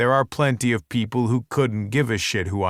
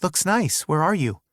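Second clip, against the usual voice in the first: higher, light woman's voice; Penelope's voice; 7 semitones higher than usual. A narrator's reading. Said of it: "comment from Penelope".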